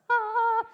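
sharp loud high yelp of surprise; sudden yelp. Real, not acted. Speaker yelps in surprise at own church joke, loud and high. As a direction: blurt out a startled high cry. Sudden outburst mid-story.

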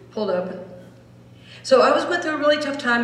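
ordinary delivery

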